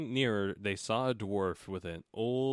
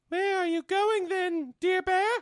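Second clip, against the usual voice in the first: put-on much higher girlish voice; 5 dB louder than usual. A narrator's reading. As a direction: pipe it up high like a sweet young girl.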